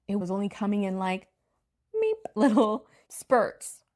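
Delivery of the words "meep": playful meep. Retold or imitated. Trickle of energy, playful noise.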